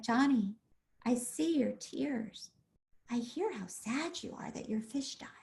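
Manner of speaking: very soft, slowing to a near-whisper; gentle validation. Shown as a demonstration. Parent at crying son's level over dead fish, gently validating. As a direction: hush down tenderly, with long pauses. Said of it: "with a gentleness and validate".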